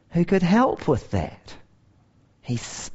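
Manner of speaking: ordinary talk, nothing marked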